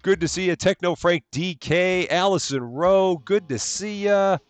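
high, sing-song hellos to each viewer; cheery stream greeting. Real, not acted. Streamer welcoming chat members by name, high and sing-song.

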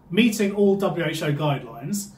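usual speaking voice

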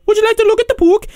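very high, quick squeaky voice; interrupting book pusher. Acted. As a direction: rattle off the offer in a squeaky pitch. Repeated interrupting catchphrase.

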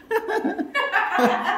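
a high helpless laugh; high burst of laughter. Real, not acted. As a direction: let out a squealing laugh. Laughing at himself.

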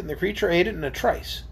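usual speaking voice

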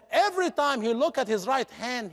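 ordinary talk, nothing marked

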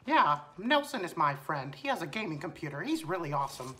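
soft cartoon mouse, swooping pitch; Mickey Mouse. Mouse explaining friend to teammate, cartoon voice. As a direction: chat as a friendly mascot. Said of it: "Mickey Mouse".

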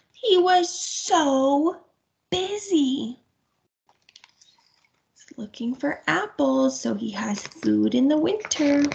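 gentle picture-book reading; reading to children. A narrator's reading. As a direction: read it to little ones. Story narration to children.

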